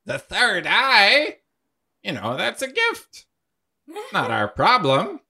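breezy ghost. Acted. Ghost brushing off the sighting, high and airy. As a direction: shrug it off as a gift.

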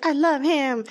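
high, dreamy swoon; mock smitten. Recalling falling for him, high smitten voice. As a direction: swoon like a lovestruck teen. Retold sudden love.

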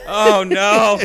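high laughing "oh no". Real, not acted. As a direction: cracks up at his own joke. Cracks up at own joke.

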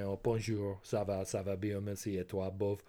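French said properly, with a little emphasis; low, soft attempt at proper French pronunciation; careful French accent. Shown as a demonstration. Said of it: "trying to speak it properly".

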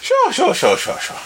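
playful Irish lilt, high on the first sure; Irish accent. Acted. Host slips into Irish agreeing to move on, in a playful Irish accent. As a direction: toss off a quick mock Irish sure. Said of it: "I went Irish for a second there".

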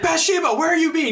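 very high mock calling voice; silly high call. Calling the ghost at the door, high silly voice. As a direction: call out in a squeaky put-on voice.

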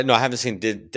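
ordinary delivery

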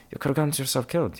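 quick, high reproach; high-pitched scolding. Acted. Traveller telling himself off, high and quick scolding.

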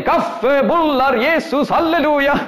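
high, loud hawker's call; a volunteer calling coffee, buns and praise at the table; joyful calling out. Retold or imitated. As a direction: cry out the wares like a happy street seller.

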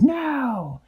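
high squeaky fairy no; defiant cry. A narrator's reading. Little fairy shouting down the giant monkey. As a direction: fling a tiny high no at the beast.

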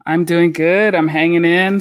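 ordinary talk

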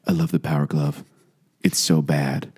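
low, cool, softened movie quote; imitating film character. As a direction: deliver it low like a smug movie line. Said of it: "he's like".

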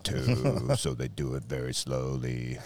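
slow, lowering drawl into the punchline; deadpan slow joke. Pretend. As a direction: draw the words out as if too lazy to hurry. Racial joke told slowly.

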